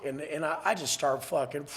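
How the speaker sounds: ordinary talk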